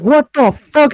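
high, loud shocked yelp; startled what the fuck. Retold or imitated. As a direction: imitate a guy whipping round in shock. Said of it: "he turned around like".

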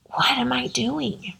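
almost no voice left, holding back tears; real tearful whispered question; choked up. Real, not acted. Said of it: "And I still get choked up".

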